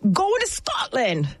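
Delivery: high, slow mock Scottish accent; a shaky attempt at Scottish. Golfer doing a bad Scottish accent for the trip. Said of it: "Is that a Scottish accent?".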